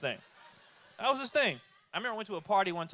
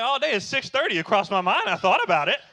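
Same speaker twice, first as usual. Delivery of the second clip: indignant protest; 2 semitones higher, 13 dB louder than usual. Retold or imitated. Kid answering dad's early wake-up call, indignant. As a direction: protest loudly in disbelief.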